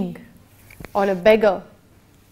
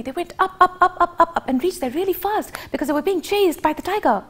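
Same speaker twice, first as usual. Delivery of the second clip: fast, higher, rushing narration; fast telling; 7 semitones higher, faster than usual. Shown as a demonstration. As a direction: hurry it up the mountain. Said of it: "we can play with speed".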